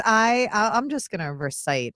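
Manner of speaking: ordinary talk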